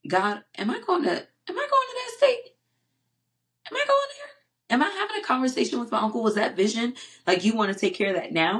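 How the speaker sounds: very high, disbelieving questions, easing down; incredulous questioning. Retold or imitated. Asking God about seeing uncle, incredulous and high. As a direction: squeak the questions in dawning disbelief. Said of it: "And I say".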